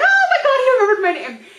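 very high, loud squeal; acted-out starstruck scream; ecstatic fangirl. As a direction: shriek it, thrilled to be recognized. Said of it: "And I was like".

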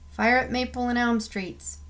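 usual speaking voice

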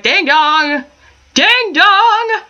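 high squawky ding dong; parrot voice. Retold or imitated. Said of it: "a bird that's just like".

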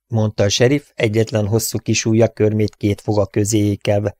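ordinary delivery, nothing marked

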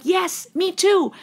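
high, drawn-out delighted yes; emphatic agreement. Acted. Listener relating to a song's lyrics, emphatic agreement. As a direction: cry out slowly, way up high, in recognition. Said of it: "it's like".

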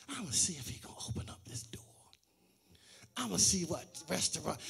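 quick, soft whisper to herself; whispered thought. Retold or imitated. Pastor voicing a woman testing her date, whispered fast. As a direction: whisper it like a secret plan.

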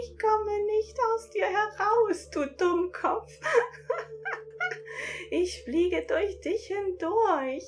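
high, giggly creature voice; tease, laughing; laughing sprite voice. A narrator's reading. Sprite mocking the narrator, high and giggly. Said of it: "kreist lachend".

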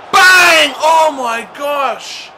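loud high bang, then slow drawn-out gasp; excited shout. Real, not acted. Gamer watching a screamer go wide, excited shout. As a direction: shout it as the shot flies. Big shot in the game.

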